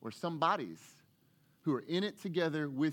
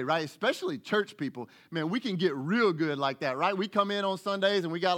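ordinary talk, nothing marked

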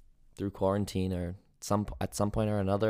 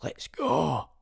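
put-on Scottish, rising in energy; Scottish accent; 5 semitones higher, 5 dB louder than usual. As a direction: rant in a Braveheart-style Scottish accent. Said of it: "in a different accent".

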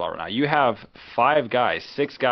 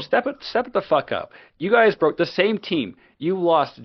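heated rant; 6 semitones higher than usual. Real, not acted.

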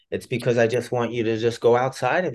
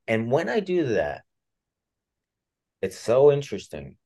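normal talking voice

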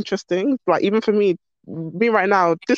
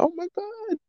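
a much higher, much softer breathy "oh my God"; gasped surprise; 13 semitones higher, 9 dB quieter than usual. Pretend. Imagining her future self finding something new, gasping in wonder. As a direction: catch your breath in sudden wonder.

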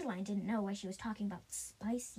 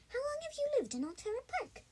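high quick little voice for Levi; Levi's voice; 13 semitones higher, faster than usual. A narrator's reading. Levi asks the bunnies, high and quick. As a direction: pitch Levi up and rattle the question off fast. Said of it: "asked Levi".

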